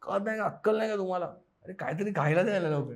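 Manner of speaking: quick, mock-angry demand; hangry outburst. Acting out his own hunger tantrum, mock angry. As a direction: rattle it off like a hungry man losing patience. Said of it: "मेन राग त्याला".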